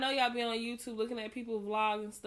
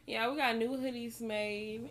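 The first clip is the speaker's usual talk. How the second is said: ordinary talk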